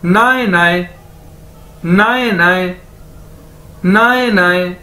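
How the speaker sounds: "नाए, नाए" run together as one word; careful language-teacher "नाए, नाए"; demonstrated pronunciation. Shown as a demonstration. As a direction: drill "नाए, नाए", pausing between takes. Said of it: "इसको दो बार बोला जाए".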